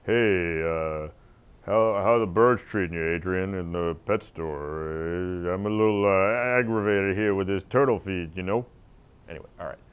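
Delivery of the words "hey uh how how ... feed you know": put-on thick Philly Stallone mumble, Italian-American accent; Rocky accent. Rocky accent for Adrian at the pet store. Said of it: "show off your Rocky accent".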